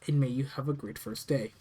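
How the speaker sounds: usual speaking voice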